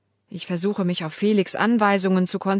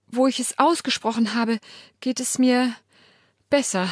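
usual speaking voice

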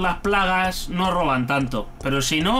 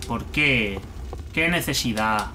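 low, sorrowful reproach; pitying lament; 7 semitones lower, slower than usual. Real, not acted. Player mourning the poor little dog, low pitying reproach. Laments what was done to the doggy.

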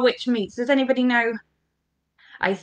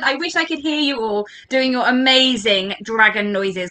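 usual speaking voice

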